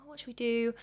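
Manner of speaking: normal talking voice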